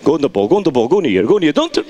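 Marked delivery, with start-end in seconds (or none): none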